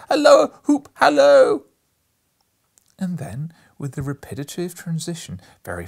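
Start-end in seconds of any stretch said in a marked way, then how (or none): 0.1-1.7 calling out. A narrator's reading. Scrooge calling out as the parrot.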